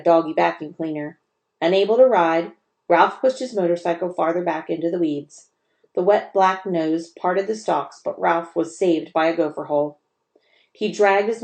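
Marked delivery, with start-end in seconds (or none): none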